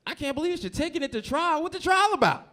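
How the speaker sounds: high-pitched comic yelling; incredulous outburst. Acted. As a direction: build to a disbelieving shout. Acted outburst over cousin choosing trial.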